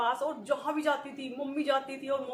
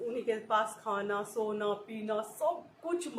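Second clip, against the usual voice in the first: ordinary delivery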